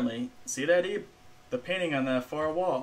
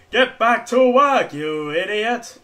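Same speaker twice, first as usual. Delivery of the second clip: slow, loud barked scolding; mock angry shout; 6 semitones higher, 9 dB louder, slower than usual. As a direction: yell it like a furious boss. Scolding himself in mock boss voice.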